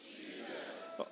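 barely audible, half-hearted reply of Jesus; a timid murmur of "Jesus" from the crowd; weak mumbled answer. Real, not acted. Congregation answering the preacher, mumbled and quiet. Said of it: "that's not how we say Jesus here".

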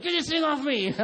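high, loud mock-frantic squeal; panicked child. Son to dad about the snake, comic high panic. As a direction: imitate a scared boy losing his cool.

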